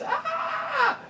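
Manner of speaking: high laughing shriek; gleeful scream. Real, not acted. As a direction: squeal with delight.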